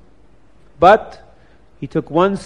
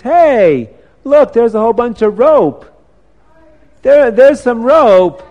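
put-on higher, louder voice of an excited boy; excited discovery; 6 semitones higher, 8 dB louder than usual. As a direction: call out a sudden find. Boy suddenly spots rope in story.

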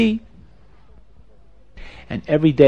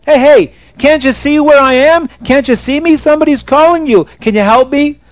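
high raised pleading call; louder, urgent plea; desperate calling; 10 semitones higher, 4 dB louder than usual. Acted. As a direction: call out to someone who won't look. King calls to ignoring passerby.

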